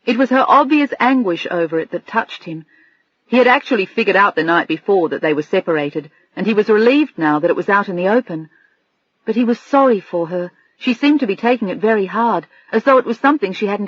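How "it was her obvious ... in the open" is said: plain reading voice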